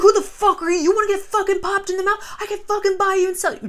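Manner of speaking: high, put-on voice, rising fury; mocking drunk rage. Drunk celebrity sneers threats at the editor, high and mocking. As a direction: sneer it like a wasted rich guy. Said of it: "He's like".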